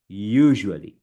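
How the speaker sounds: USUALLY as YOO-zhuh-lee, buzzing zh; careful model pronunciation. Shown as a demonstration. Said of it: "Break it, guys".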